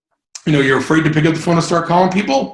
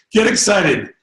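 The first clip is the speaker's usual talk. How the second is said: usual speaking voice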